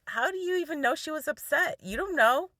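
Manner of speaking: mildly indignant imitation, high and quick; mad husband. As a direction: imitate a spouse's annoyed challenge. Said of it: "and he was mad".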